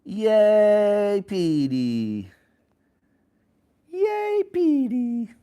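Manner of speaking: high, loud drawn-out hurrah; excited cheering. Real, not acted. Parent cheering Petey's effort, high excited cheer.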